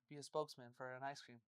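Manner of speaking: ordinary talk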